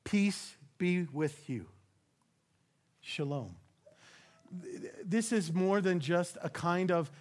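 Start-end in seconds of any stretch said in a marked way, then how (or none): 0.0-1.7 slow, hushed and low, savouring each word; quiet tender peace be with you; soft reverent blessing. Shown as a demonstration. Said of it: "And what does he say?". 3.0-3.6 barely breathed, low and soft; soft whispered-down blessing; hushed shalom. Shown as a demonstration. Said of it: "And what does he say?".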